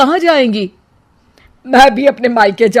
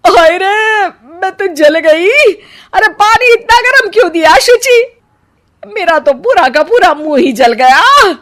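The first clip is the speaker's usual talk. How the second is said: scalded wail; 8 semitones higher than usual. Acted. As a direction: wail as if your mouth is burning. Mother-in-law scalded by gargle water.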